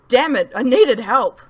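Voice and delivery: slow, loud desperate cry for help. Acted. Boy in panic by the blaze calling for help, loud. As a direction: shout it out, frantic.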